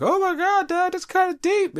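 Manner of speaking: high squeaky mock children; mock kids' voice. His kids reacting to his clone threat, high mock kids. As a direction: imitate awed little kids. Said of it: "they be looking like".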